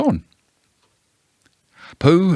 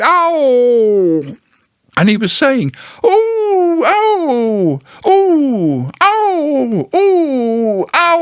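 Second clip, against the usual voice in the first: high squeaky ooh and ow cries; Piglet's ooh and ow; 4 dB louder, much slower than usual. Acted. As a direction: alternate little jolted cries. Piglet jolted repeatedly.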